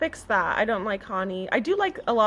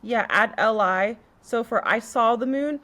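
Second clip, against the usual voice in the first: ordinary delivery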